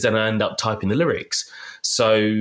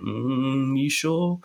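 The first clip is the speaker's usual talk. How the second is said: skeptical, raised pitch, quizzical; doubtful inner voice; 5 semitones higher, much slower than usual. Retold or imitated. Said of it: "I kind of think".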